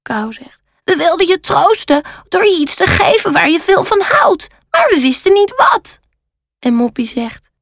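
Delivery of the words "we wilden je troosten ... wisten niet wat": high-pitched crow explaining; high storybook crow owning up; apologetic crow. A narrator's reading. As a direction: play a well-meaning crow in a high voice. Kou explains to Rad.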